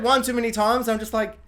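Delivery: usual speaking voice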